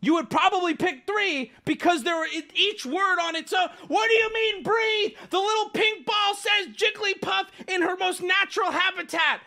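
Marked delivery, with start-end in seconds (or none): 3.9-9.4 high-pitched, incredulous yelling; heated, raised-voice protest; exasperated outburst. Real, not acted. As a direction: shout it in disbelief at chat. Outraged reply to a chatter.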